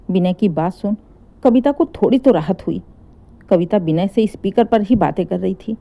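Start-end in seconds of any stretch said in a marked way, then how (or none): none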